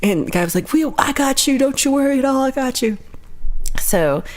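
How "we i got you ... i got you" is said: high-pitched, soothing imitation; lightly voiced high reassurance; reassuring gate worker. Retold gate worker reassuring her.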